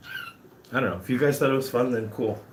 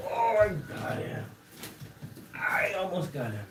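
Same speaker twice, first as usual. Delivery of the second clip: thrilled at nearly catching him, higher; excited chase; 11 semitones higher, 4 dB quieter than usual. Real, not acted. Chasing the guinea pig.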